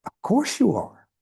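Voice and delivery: imitating an incredulous servant; mildly incredulous. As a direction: answer as if it were obvious, a touch incredulous. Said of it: "kind of incredulous, said".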